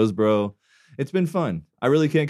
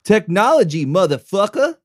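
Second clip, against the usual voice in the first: higher, louder mock catchphrase; imitating a catchphrase; 9 semitones higher, 7 dB louder than usual. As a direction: parrot his line in a goofy voice. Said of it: "What is that?".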